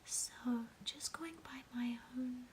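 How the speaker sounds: ordinary talk, nothing marked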